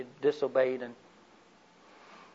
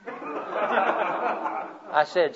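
ordinary delivery

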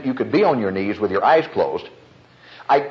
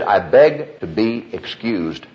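each word of the excuse drawn out separately; slow, spelled-out repeat; 2 semitones lower, slower than usual. Retold or imitated. Deacon repeating his excuse for the preacher, slow and distinct. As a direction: say it slowly so it can be understood. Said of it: "you said it so fast".

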